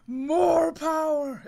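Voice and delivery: high, slow, loud war cry; power-hungry shout. Acted. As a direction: roar it out like a warrior. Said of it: "he shouts and everything".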